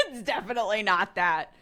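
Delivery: amused denial with laughter in it; real laugh through the words. Real, not acted. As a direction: laugh while saying no. Laughing denial, then repeated soberly.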